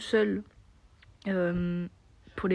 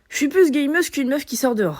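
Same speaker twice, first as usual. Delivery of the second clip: enthousiaste; 6 semitones higher, 9 dB louder than usual. Real, not acted. Trouve enfin la formule, ravie.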